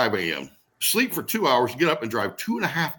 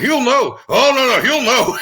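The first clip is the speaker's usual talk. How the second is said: higher and louder, anxious repeating; scared insistence; 11 semitones higher, 9 dB louder, slower than usual. Retold or imitated. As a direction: insist in panic that dad will find out. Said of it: "I was scared to death".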